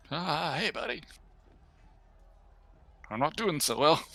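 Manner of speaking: softly acted wounded character; hurt buddy. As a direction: feebly greet a friend while hurt. Voices hurt character greeting a friend.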